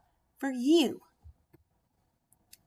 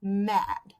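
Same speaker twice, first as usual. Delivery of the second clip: usual speaking voice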